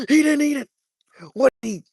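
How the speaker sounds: gasping, high and loud disbelief; real shock as the fish refuses the bait; stunned gasp. Real, not acted. Bass ignores the worm.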